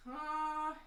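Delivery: usual speaking voice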